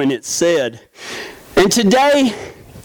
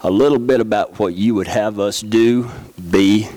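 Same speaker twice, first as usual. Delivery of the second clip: prayer; 10 semitones lower than usual. Acted. Closing prayer.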